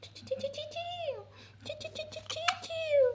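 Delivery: put-on tiny high-pitched chirps to a baby; playful tickle noises. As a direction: squeak "chiu" in a rapid, playful run. (tinis nga huni nga nagkitik sa bata)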